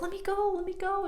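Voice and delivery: high, small pleading voice; mock pleading. Pinned wrestler begs to be released.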